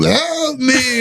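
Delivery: high, drawn-out eerie wail; rasping spirit voice. Retold or imitated. The thing calls while girlfriend sleeps, rasping. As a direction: mimic the rasping thing kicking off. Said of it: "this thing's kicking off".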